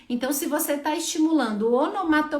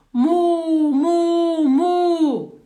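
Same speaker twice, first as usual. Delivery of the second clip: loud, higher "mu, mu, mu" drawn out; repeated loud moos; 6 semitones higher, 10 dB louder, much slower than usual. Shown as a demonstration. Therapist modelling repeated moos for a patient. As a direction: moo over and over for the child to copy. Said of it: "várias vezes, vamos".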